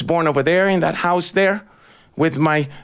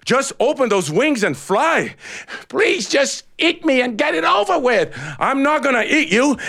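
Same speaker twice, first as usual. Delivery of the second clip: higher, begging little voice; pleading chicken; 8 semitones higher than usual. A narrator's reading. Chicken begging the eagle to eat it. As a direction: beg to be eaten and done.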